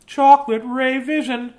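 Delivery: high amazed repeat; excited high. Real, not acted.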